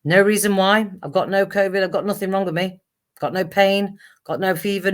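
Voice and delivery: normal talking voice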